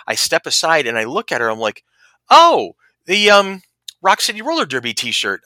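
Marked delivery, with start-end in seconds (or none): 2.3-5.4 high, loud "oh", then searching; retold pleasant surprise, pitched up; surprised recognition. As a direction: blurt it out as it dawns on you. Retells recognizing the woman's shirt.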